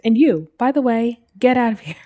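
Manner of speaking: half-hearted shouted dismissal; mock yell. Uncle yells at the nephew as he leaves, mild mock yell. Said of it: "yelled and was like".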